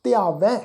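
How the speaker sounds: ਧਿਆਵੈ with a nasalised open "ain" ending; nasal dulavan ending. Shown as a demonstration. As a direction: send the final ai of ਧਿਆਵੈ through the nose. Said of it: "ਨਾਲ ਬਿੰਦੀ ਸਹਿਤ ਨਾਸਿਕ ਉਚਾਰਨ ਕਰਾਂਗੇ". (ਧਿਆਵੈ, ਦੁਲਾਵਾਂ ਵਾਂਗ ਨਾਸਿਕ ਉਚਾਰਨ)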